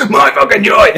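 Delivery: high, loud, laughing put-on voice; mock exuberant voice. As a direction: burst out high and silly through laughter. Mock joyful outburst, laughing.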